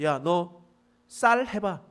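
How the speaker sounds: high, loud commanding demand; barked challenge. Retold or imitated. Guard at the ferry demands the test word, loud and high. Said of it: "라고 했는데".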